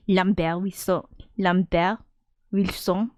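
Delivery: "Lambert Wilson" as "lahm-BEHR weel-SON"; Frenchify "Lambert Wilson", silent t and nasal ending; "Lambert Wilson" in mock French; French pronunciation. Shown as a demonstration. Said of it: "doesn't sound like a French name".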